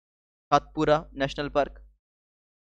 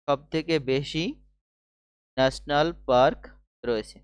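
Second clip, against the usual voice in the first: measured, phrase by phrase with gaps; slow dictation; slower than usual. Shown as a demonstration. Teacher dictating a fact to students, slow dictation. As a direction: spell it out slowly for note-takers.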